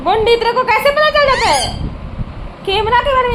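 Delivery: high laughing disbelief; amused high-pitched chatter to the parrot; laughing pet talk. Real, not acted. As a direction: laugh at the clever bird in a high voice.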